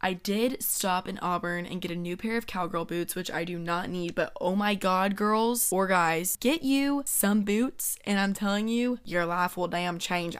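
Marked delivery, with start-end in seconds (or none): 5.1-10.4 slow, drawn-out Southern country voice; lightly put-on Southern accent; country twang. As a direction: drawl it like a country girl selling boots. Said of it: "I can't stop talking like this".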